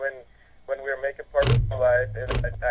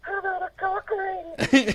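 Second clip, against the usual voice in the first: very high, slow falsetto; fake woman voice; slower than usual. Prank caller as a woman, very high slow falsetto. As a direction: sweetly ask it slow and high. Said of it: "I did the woman's voice".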